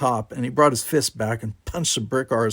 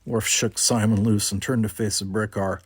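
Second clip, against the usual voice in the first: usual speaking voice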